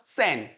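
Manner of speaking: सेन with an 'e' vowel; correct first syllable. Shown as a demonstration. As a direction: drill सेन as 'sen', falling. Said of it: "इसको बोलिए पहले सेन". (सेन का सही उच्चारण)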